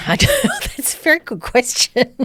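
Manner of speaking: laughing through the words. Real, not acted. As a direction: giggle while answering. Laughing at a cheeky question.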